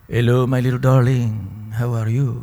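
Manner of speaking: low, soft crooning Latin lover; purring Latin lover. Shown as a demonstration. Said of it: "I'm the lover boy, the Latin lover".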